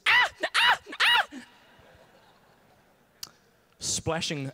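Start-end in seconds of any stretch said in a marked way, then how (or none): 0.1-1.4 short, high, loud mock shrieks; girlish freak-out screams. Comedian mimicking his buff friend freaking out in the sea, girlish shrieks. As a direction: imitate girlish panicked screams in bursts. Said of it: "freak out like a girl".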